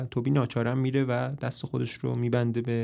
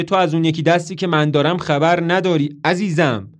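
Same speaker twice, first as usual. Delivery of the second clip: louder, pointed retort; loudly; 4 semitones higher, 7 dB louder than usual. A narrator's reading. Toby loudly answering Marilyn for Carmichael's ears. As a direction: say it aloud for him to hear. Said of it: "با صدای بلند میگه".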